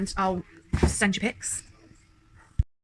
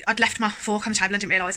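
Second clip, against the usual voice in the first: usual speaking voice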